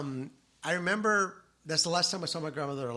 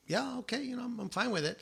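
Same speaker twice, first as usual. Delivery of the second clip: grandma's reply; 8 semitones higher, 4 dB quieter than usual. Retold or imitated. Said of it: "my grandma was like".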